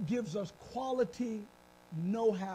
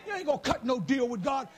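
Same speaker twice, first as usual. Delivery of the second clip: ordinary delivery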